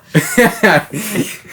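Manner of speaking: high rising giggle. Real, not acted. Laughing at own slip.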